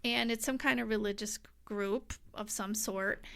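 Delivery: ordinary delivery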